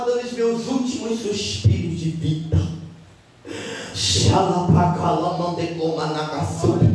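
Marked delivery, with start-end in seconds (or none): 3.9-6.9 slow, lower ecstatic tongues; speaking in tongues. Real, not acted. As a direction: let the tongues roll out slowly. Speaking in tongues mid-sermon.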